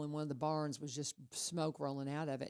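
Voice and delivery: ordinary talk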